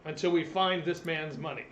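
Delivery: a commander ordering his men to search the inn; guard commander's order. Acted. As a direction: bark the order to the troops. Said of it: "the commander of the guard goes".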